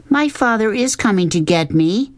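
high, tightly insistent; tight-lipped. A narrator's reading. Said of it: "Sistine said through tight lips".

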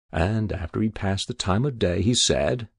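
ordinary delivery, nothing marked

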